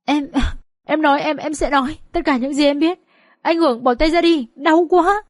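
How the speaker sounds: high, stammering surrender in pain; hasty reply. Acted. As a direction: blurt it out, pleading to be released. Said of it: "Kha vội đáp".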